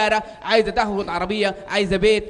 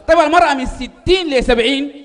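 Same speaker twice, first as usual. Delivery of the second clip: louder, slower, building question; escalating comedy rant; 4 semitones higher, 7 dB louder than usual. Real, not acted. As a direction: push the setup hard.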